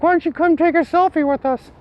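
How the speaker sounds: high, chummy cop voice; imitating Josh. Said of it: "Josh is like".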